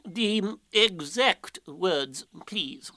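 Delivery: very slow, insistent owl; drawn-out pompous request, softening at the end; ignoring interruption. Acted. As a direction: repeat the demand ponderously. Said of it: "as if Rabbit hadn't spoken".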